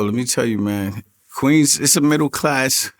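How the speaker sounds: ordinary talk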